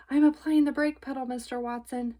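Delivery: high, fast, loud shout. A narrator's reading. Baby shouting across the car to Mister Watson. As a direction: call it out over the noise. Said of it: "Baby shouted".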